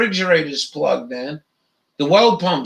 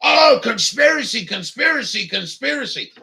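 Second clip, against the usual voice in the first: mocking shout; 5 semitones higher than usual. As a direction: mimic him yelling conspiracy, then let it trail off. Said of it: "he fucking jumped on me".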